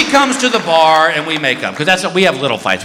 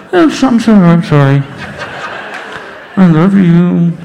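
talking through a snorkel; 5 dB louder than usual. Retold or imitated.

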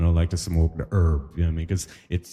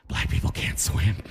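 hushed whisper; 3 semitones lower than usual. Retold or imitated. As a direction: lean in and whisper it. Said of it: "why are you whispering?".

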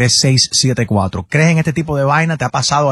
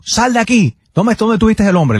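stern mother; 7 semitones higher than usual. Retold or imitated. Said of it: "mira la manera que lo hizo Kazu".

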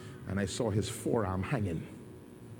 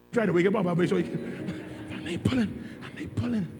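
full-force preaching; 9 semitones higher than usual. Acted. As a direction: preach it to the back row.

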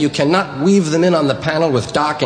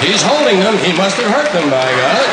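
higher sportscaster voice; mock play-by-play announcer; Kurt Gowdy; 6 semitones higher than usual. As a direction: comment on an injury to the co-commentator. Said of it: "But he can't say".

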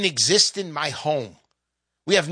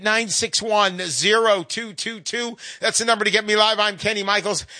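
normal talking voice

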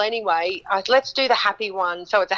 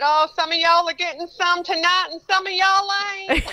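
cheeky stage quip; 11 semitones higher than usual. Retold or imitated. Singer teasing the audience from the stage, cheeky higher voice. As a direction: tease the crowd. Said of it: "I said".

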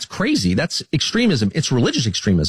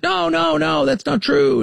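much higher, mock-incredulous no no no; mimicking Trump's denial; slower than usual. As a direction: toss off the denial like a dismissive president. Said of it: "And he's like".

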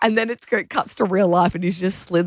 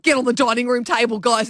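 Steve Irwin mode; 6 semitones higher than usual. Retold or imitated. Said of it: "Steve Irwin mode".

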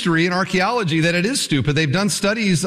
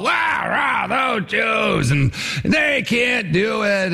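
rowdy, slurred mob chant, held long; drunken mob; 5 semitones higher, much slower than usual. Retold or imitated. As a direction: egg on the crowd like a drunk. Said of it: "drunken sort of mob scene".

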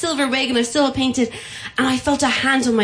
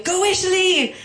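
retold cheer; 9 semitones higher than usual. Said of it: "people screaming".

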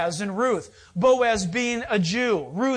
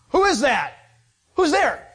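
louder and higher, a sudden call into the dark; startled waking; 4 semitones higher, 5 dB louder than usual. Acted. Boaz wakes to someone at his feet, startled louder call. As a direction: jolt awake and call out who's there.

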